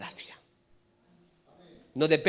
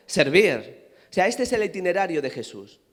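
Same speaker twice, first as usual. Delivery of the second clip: ordinary delivery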